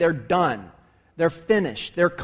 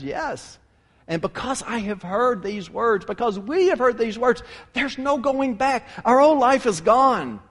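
heartfelt declaration; 6 semitones higher, 3 dB louder than usual. Acted. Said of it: "And Peter says".

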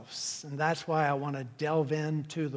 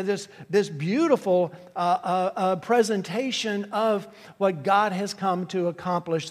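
preaching at full force; 4 semitones higher, 4 dB louder than usual. Acted. Preaching climax.

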